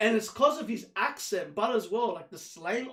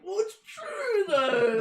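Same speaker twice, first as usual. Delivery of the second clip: sassy retort; 10 semitones higher, 4 dB louder, much slower than usual. Retold or imitated. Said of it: "And I'm like".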